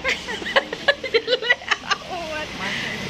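giggling fit; real burst of laughter. Real, not acted. Laughing at her hair story.

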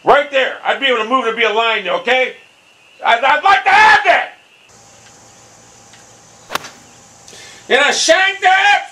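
high voice building to a yell; exasperated rant. Real, not acted. Gamer demanding an aim line, exasperated yelling. Ranting, then shanks the shot.

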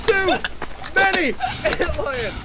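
high frantic shouting; panicked yelling. Real, not acted. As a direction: yell at him in panic. Friend draws antlions to them.

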